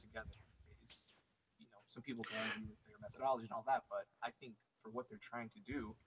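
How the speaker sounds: faint, far from the mic; speaking too far from the microphone; barely audible. Real, not acted. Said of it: "I can barely hear you".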